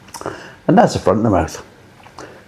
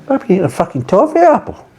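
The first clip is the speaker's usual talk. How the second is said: mock disgust; 11 semitones higher than usual. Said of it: "as you said, I think".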